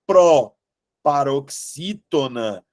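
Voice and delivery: "proparoxítona" slow, with a break after pro; drawn-out term. Shown as a demonstration. Teacher correcting the word's category, slowly sounding it out. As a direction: stretch out "proparoxítona" in separate chunks.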